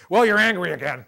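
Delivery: high, loud, pointed jab; accusing "angry again". Shown as a demonstration. Counselor showing the wrong way to call out anger, loud and high. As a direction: say it as a blaming jab. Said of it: "I don't mean by saying".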